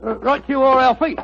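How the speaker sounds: a light cockney helper's reply; lightly put-on London cockney; cockney mate. Disguised Watson answering his partner in light cockney. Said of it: "What barbaric jargon do you speak?".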